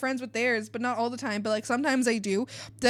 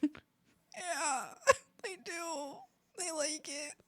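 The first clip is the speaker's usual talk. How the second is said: much softer, half laughing; embarrassed mumble; 3 semitones higher, 9 dB quieter, much slower than usual. Retold or imitated. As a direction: sheepishly mumble it out. Sheepish answer to relatives.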